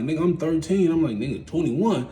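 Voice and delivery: put-on a kid reeling from his sentence; disbelief. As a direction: pitch up in shocked disbelief.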